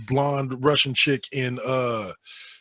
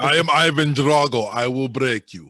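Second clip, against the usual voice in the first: lightly voiced Russian Ivan Drago; cold, heavy Russian villain threat; Russian accent; 4 dB louder than usual. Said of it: "paint the image of Dolph".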